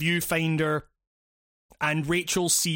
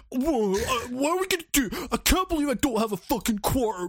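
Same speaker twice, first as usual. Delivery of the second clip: higher, whiny mock tantrum as Rachel; 6 semitones higher than usual. Imitating Rachel throwing a tantrum over a broken viewfinder. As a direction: ham up a spoiled teen's meltdown. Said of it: "she throws a fucking tantrum".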